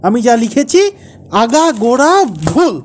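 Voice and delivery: loud, high-pitched indignation; angry outburst. Acted. Husband hears wife calling his story wrong, angry outburst. Husband erupts over perceived criticism.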